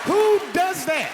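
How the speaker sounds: high, slow disbelieving yell; outraged shout. Real, not acted. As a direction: shout it to the crowd. Outraged at wife.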